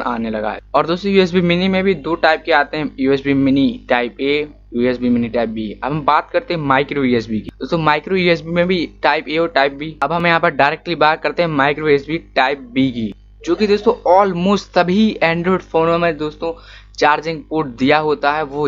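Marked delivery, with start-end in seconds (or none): none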